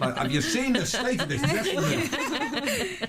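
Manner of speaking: nagging mum voice. Pretend. Radio host mimicking nagging mum.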